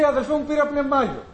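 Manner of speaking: high, delighted announcement; joyful. Retold or imitated. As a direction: burst out with happy news to a brother. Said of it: "χαρούμενος".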